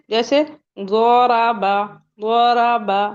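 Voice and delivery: ज़ोराबा slow and loud, the ḍ heavy from the tongue's side; ḍād example. Shown as a demonstration. Teacher gives students a slow example of the ḍād sound. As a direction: draw out ज़ोराबा with a full heavy ḍ. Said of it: "कैसे?".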